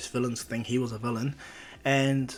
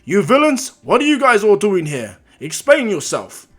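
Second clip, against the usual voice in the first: booming, high heroic challenge; All Might; 7 semitones higher, 12 dB louder than usual. Retold or imitated. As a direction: confront villains in a loud hero voice. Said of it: "All Might goes".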